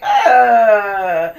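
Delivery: loud, laughing grunt of disgust. Real, not acted. The host acting out her judgy side, a loud laughing uh. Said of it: "mock disgust grunt".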